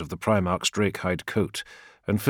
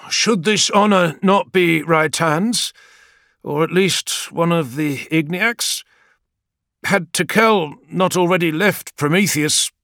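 higher, louder, slow questioning; doubting warrior; 10 semitones higher, 6 dB louder than usual. Acted. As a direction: press the question humbly. Zaitos questions.

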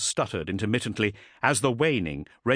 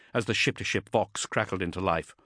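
ordinary delivery, nothing marked

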